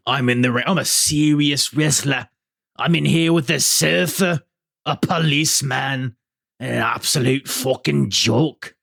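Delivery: put-on Liverpool accent, voice pushed up a little; Scouse accent. Podcaster quoting wrestler Zach Gibson's mic rant, Scouse accent. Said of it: "That's a good Scouse accent".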